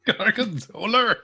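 very high, slow mock Scottish Gorgonzola; mocking a friend's accent, very high and slow. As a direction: tease him by saying Gorgonzola in a silly Scottish voice. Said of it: "It's not Scottish, Hodge".

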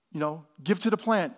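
ordinary talk

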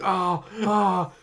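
high, loud imitated yelps; mock cries. Host imitating the boy being spray-painted, mock cries. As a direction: mimic a victim crying out, hammed-up.

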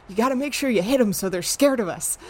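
fast, high excited shout; yell. Acted. Arlette yelling to Buddy mid-chase, fast shout. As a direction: holler it while charging after them.

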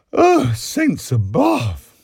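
high, loud, drawn-out sigh; slow exhausted wail; sighed exclamation. A narrator's reading. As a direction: heave it out slowly after too much laughing. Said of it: "sighed above her jumping rose".

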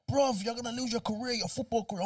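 alarmed friend. Retold or imitated. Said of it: "the lad was like".

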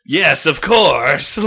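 slow, loud, condescending; haughty Dark Lord. Acted. Satan answers the demon, slow, loud and haughty. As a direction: drag it out with lordly impatience.

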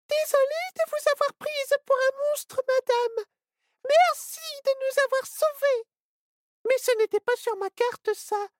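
put-on very high little-girl voice, apologetic; a tiny girl saying sorry and thank you; little girl Ciboulette. Little girl apologises and thanks the spider, very high and sorry.

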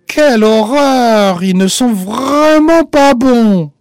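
high, slow, loud horrified narration; dramatic disgust. A narrator's reading. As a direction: drag it out in comic horror.

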